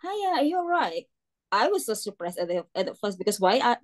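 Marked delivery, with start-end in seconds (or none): 0.0-1.1 higher, lilting Hiya with falling end; British shop greeting; imitating British greeting. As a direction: mimic the local greeting, lifted up. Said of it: "It's like".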